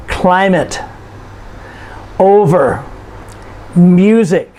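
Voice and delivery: CLI-mate, O-ver, MU-sic, each stressed on the first syllable. Shown as a demonstration. Teacher gives examples of two-syllable nouns, first syllables stressed: CLI-mate, O-ver, MU-sic. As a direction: lean on CLI in climate, O in over, MU in music. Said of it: "we stress the first syllable".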